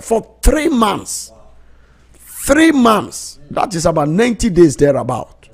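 high, loud, stretched preaching; fervent preaching. Acted. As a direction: preach it out with full fire. Preacher building to full force.

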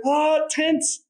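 very high strained mock cry; imitating a woman straining at climax. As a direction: imitate her tensing up. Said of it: "And then she fucking".

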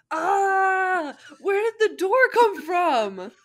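high loud yelp of shock; panicked shout. Acted. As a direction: scream in surprised pain. Naruto notices trapped hands.

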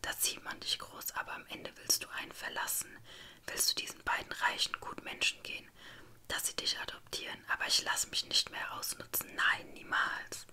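plain reading voice

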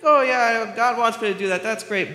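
high, loud sarcastic enthusiasm; mock eager. Pastor mocking believers' excuses, high fake enthusiasm. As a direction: gush with fake excitement.

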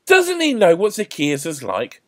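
loud, high outraged question; indignant. A narrator's reading. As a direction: protest it to the crowd. Indignant onlooker in the crowd.

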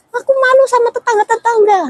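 high, strained mock yell of the in-law; retold shouting. In-law yelling about shame before the neighbours, high mock shout. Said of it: "teriak-teriak".

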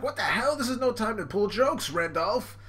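high, exasperated mock scolding; hammed-up indignant outburst; scolding villainess. Angry Brulee scolding Randolph.

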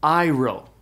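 iro said slowly, "eye-roh"; slow careful Greek word. Shown as a demonstration. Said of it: "is pronounced iro".

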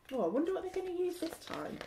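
whisper-quick teasing aside; playful aside. Real, not acted. Reader teasing the child listener, quick soft aside. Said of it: "reader's aside to listener".

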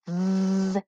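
"V-A" as a held v sound, then a long vowel. Shown as a demonstration. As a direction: tap out "V-A" sound by sound, not letter names. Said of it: "Finger tap the sounds in the first syllable".

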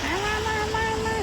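high, sing-song call to a little one; high coaxing come-here call. Real, not acted. Calling the child in the rain.

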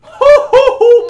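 high, loud laughing whoop; amazed whoop. Real, not acted. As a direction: burst out laughing in disbelief. Amazed at made shot.

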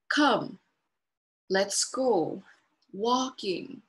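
short, slow, spaced cue words; slow simple prompts. Shown as a demonstration. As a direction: say each cue word slowly with a pause. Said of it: "simplifying what we say and kinda how we say it".

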